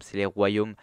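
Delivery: ordinary delivery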